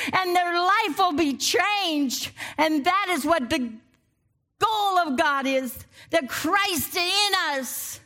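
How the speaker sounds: rising, ringing preacher's cadence; full-force preaching. Acted. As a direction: lift the congregation with each line.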